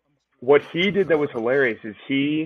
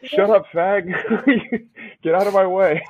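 higher-pitched mock rude comeback; retold brush-off; 6 semitones higher, 3 dB louder than usual. Retelling his comeback to a stranger, mock rude. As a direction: bat it back dismissively, pitched up.

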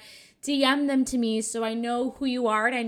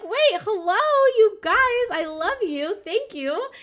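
high, loud, gushing thank-you to fans; 10 semitones higher, 6 dB louder than usual. Retold or imitated. Host gushing thanks to listeners who leave reviews, excited high squeal. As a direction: squeal it like a delighted fan-favourite host. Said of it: "I'm like".

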